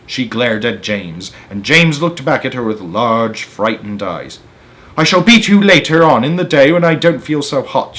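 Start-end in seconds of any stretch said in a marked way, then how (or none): 5.0-8.0 cruel threat. Acted. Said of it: "she said".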